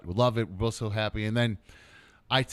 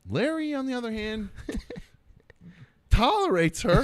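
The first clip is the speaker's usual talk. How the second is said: high, giggling punchline about Larry; laughing tease; 7 semitones higher than usual. Retold or imitated. Host teasing Larry while retelling, laughing high. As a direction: laugh through the dig at Larry. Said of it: "And then I go".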